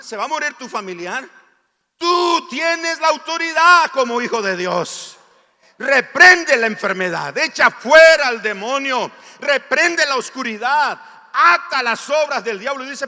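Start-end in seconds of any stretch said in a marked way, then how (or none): 2.0-12.8 loud, higher preaching, rising up; fiery preaching. Acted. Pastor urging believers to rebuke the devil, loud fiery preaching. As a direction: preach it out to the whole church at full force.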